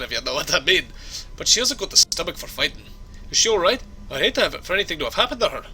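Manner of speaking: hammed-up Scottish brogue; Scottish accent. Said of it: "my Scottish accent needs some work".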